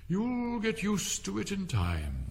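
high, slow and drawn out; dismissive caterpillar. A narrator's reading. Caterpillar dismissing Alice's plea, slow and lofty. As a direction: stretch it out loftily.

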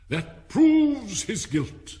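loud, high, drawn-out proclamation; condemning Queen. A narrator's reading. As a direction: pronounce guilt slowly at full royal volume.

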